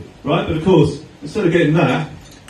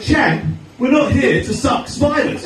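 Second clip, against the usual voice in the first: mock stern cop; 8 semitones higher, slower than usual. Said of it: "He went".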